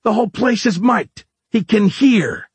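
high-pitched, exasperated alarm; urgent, voice pushed right up; panicked warning. Acted.

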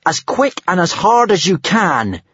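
high-pitched urgent cry; shrieking. A narrator's reading. Hiccup urges the dragon to dive, shrieking. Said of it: "shrieked Hiccup".

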